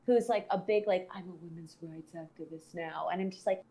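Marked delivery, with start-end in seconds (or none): 1.1-3.1 lower, softer mock self-righteous voice; mocking quote. Teller mimics the school bully's claim, mocking. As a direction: quote her in a low, quiet mock tone.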